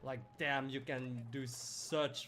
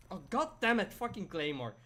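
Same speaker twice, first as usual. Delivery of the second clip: frustrated; 6 semitones higher, 8 dB louder than usual. Real, not acted. Frustrated at game.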